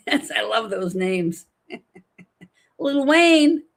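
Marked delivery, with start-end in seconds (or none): 1.7-3.6 laughing through the name; amused, laughing delivery. Real, not acted. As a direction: giggle out the cat's name.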